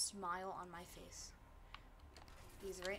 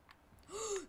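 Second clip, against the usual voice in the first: sharp gasp; 9 semitones higher than usual. Real, not acted. Shocked at opponent's loot.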